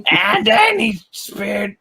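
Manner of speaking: high, slow put-on voice; impression attempt. Trying the voice before giving up, high slow mimicry. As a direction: push the voice up high and drag it.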